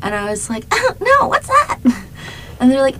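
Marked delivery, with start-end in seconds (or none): 0.7-1.8 very high, squeaky-pitched innocent question; naive surprise. Retold or imitated. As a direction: play up the clueless newcomer in a high voice. Voices own naive reaction to friends.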